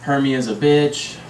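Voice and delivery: words droned on one level note; droning mental list; wandering train of thought. Acted. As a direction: let each thought slide into the next, monotone. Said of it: "My mind went dermatology".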